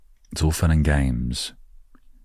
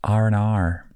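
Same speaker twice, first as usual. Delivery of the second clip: "R and R" in an American accent, higher, r's rolled in; 7 semitones higher than usual. Shown as a demonstration. Showing listeners the trick in an American accent. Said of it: "in an American accent".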